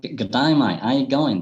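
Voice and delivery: put-on Australian greeting voice; Aussie accent. Speaker showing a learner different Englishes, mock Aussie accent. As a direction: imitate a broad Aussie accent. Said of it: "that's very Aussie".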